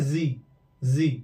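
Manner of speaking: जी as "zee", low and falling; buzzing Z. Shown as a demonstration. Teacher drilling the American reading, buzzing Z. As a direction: say जी with a vibrating z, then repeat. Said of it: "ये vibration आता है".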